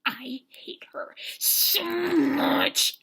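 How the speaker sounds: very slow, drawn-out venomous whisper; mock seething hatred. Sydney watching her rival, mock seething. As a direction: stretch the whisper out with loathing.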